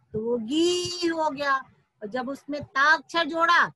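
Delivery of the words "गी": गी with a drawn-out ee; long vowel. Shown as a demonstration. Teacher showing what गा becomes, long-held गी. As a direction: sustain the vowel in गी. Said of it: "बड़ी की मात्रा जोड़ी".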